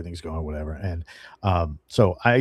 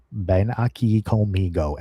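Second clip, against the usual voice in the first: put-on slow, laboured Spanish in an American accent; mock American-accented Spanish, drawn out; non-Spanish-speaker's accent; slower than usual. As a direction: imitate an English speaker sounding out Spanish. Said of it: "my wife doesn't speak Spanish".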